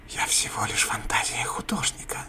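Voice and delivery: creeping nightmare whisper; the darkness itself whispering. Acted. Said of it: "прошептала сама тьма".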